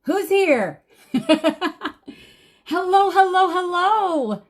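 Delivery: high, bright-eyed hello to the crowd, with a laugh; excited greeting. Real, not acted. Opening a live class, greeting.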